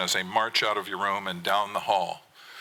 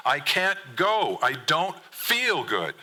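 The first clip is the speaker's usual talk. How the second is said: slow, higher, drawn-out whine; whiny refusal; 7 semitones higher, much slower than usual. Acted. Son refusing the trip, slow higher whine. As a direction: stretch it out like a miserable kid.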